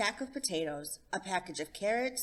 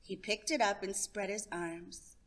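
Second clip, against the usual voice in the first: plain reading voice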